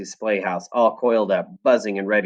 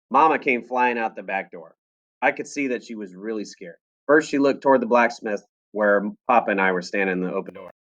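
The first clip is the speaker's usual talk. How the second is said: usual speaking voice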